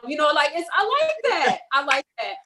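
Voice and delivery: a quick try at Jamaican patois; Jamaican accent. Pretend. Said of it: "Listen, I tried".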